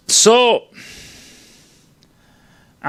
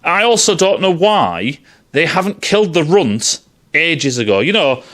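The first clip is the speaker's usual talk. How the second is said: ordinary delivery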